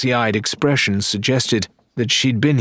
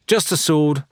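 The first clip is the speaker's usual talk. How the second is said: interrupting; 7 semitones higher than usual. Acted. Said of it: "Lorena interrupted".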